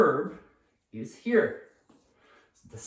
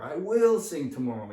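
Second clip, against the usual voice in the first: "will" hit hard again; stress on will; 3 dB louder than usual. Shown as a demonstration. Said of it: "You put the stress on the auxiliary".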